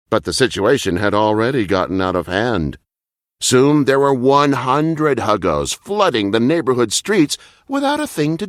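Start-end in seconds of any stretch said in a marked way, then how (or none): none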